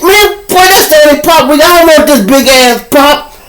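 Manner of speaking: high, loud put-on back-and-forth; mock argument. Cashier and fresh-out man bicker over pop, high and loud. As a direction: play both sides of the pop fight, shrill.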